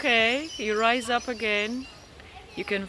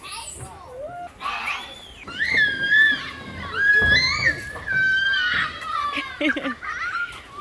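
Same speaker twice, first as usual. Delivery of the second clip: delighted shriek of laughter. Real, not acted. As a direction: squeal with delight. Child comes down slide.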